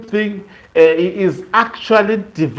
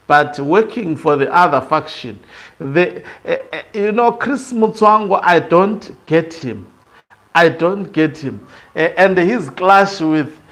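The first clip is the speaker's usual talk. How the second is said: ordinary delivery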